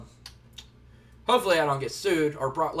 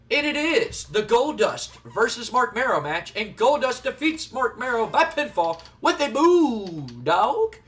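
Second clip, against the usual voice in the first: slow, drawn-out match call, building louder; announcer; 5 semitones higher, 5 dB louder, slower than usual. Pretend. Announcing the match winner, drawn-out announcer delivery. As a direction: call the result like a ring announcer.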